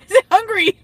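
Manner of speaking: high squeaky playful voice; cutesy baby voice. Retold or imitated. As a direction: coo it up high like asking a pet. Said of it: "and be like".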